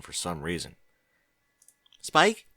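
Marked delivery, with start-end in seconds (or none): 2.0-2.5 calling out. Acted. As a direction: call Spike's name, higher and louder. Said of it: "she called".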